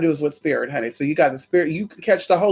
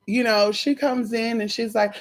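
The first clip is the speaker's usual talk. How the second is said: ordinary delivery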